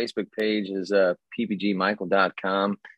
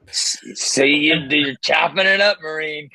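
loud gung-ho soldier; 8 semitones higher, 6 dB louder than usual. Pretend. Ribbing a fellow Marine.

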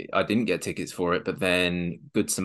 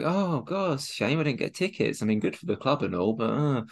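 mock sad voice; 6 semitones higher than usual. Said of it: "sad tweet".

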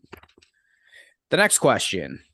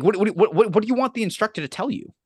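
high, fast disbelieving question; incredulous; 10 semitones higher than usual. Real, not acted. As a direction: demand an answer in disbelief. Incredulous outburst.

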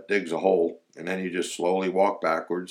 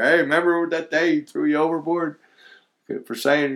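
teasing; 9 semitones higher, 5 dB louder than usual. Retold or imitated. Eddie teasing Gary.

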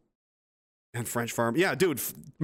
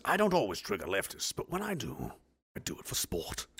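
dropping to a murmur; 6 semitones lower, 7 dB quieter than usual. Shown as a demonstration. Reading meme quote.

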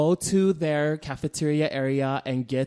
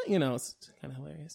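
ordinary delivery

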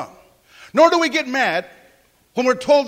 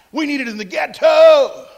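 mock shout; 5 semitones higher, 7 dB louder than usual. Joking mock plea from congregation.

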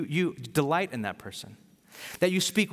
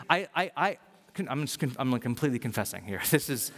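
normal talking voice

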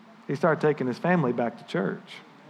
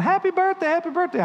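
high, sing-song mock of a crowd calling happy birthday; high cheerful mimicked birthday call; cheery group greeting; 11 semitones higher than usual. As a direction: chirp it up high like a room full of well-wishers. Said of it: "I hear all these people going".

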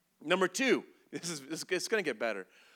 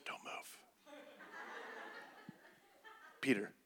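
secretive stage whisper; comic under-the-breath commands; hushed urgent warning; 8 dB quieter, faster than usual. Acted. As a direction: quietly order friends to freeze. Jesus hushing disciples as bear approaches.

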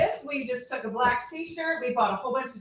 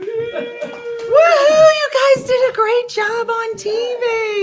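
excited cheer; 12 semitones higher, 9 dB louder, much slower than usual. Real, not acted. Cheering the guests.

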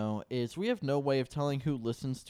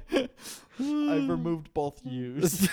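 slow words through laughter; laughing; 5 semitones higher, 4 dB louder, much slower than usual. Real, not acted. Laughing at own email mistake.

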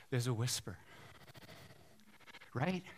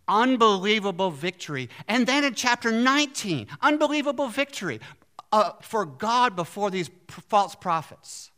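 loud, high preacher's build; rising proclamation; 5 semitones higher, 6 dB louder than usual. Real, not acted. Preacher to his congregation, louder and higher. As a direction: proclaim the victory with rising force.

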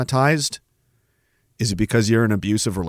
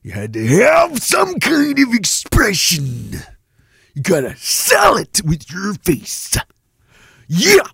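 big, slow wrestling promo shout, much higher; 7 semitones higher, slower than usual. Retold or imitated. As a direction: sell it like a pro wrestler cutting a promo.

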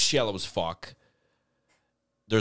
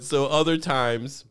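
usual speaking voice

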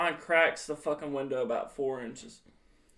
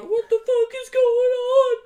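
high, loud panicked squeal, imitating himself as a kid; freaking out; 7 dB louder than usual. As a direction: act out a child's shrill, hammed-up panic. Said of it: "I'm freaking out in the back seat".

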